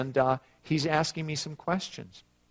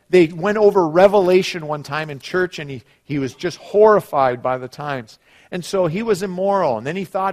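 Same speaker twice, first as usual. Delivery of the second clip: raised, forceful preacher's storytelling; emphatic preaching; 6 semitones higher, 9 dB louder than usual. Acted. Preacher telling of a man religiously deceived, emphatic preaching. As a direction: press each point on the congregation.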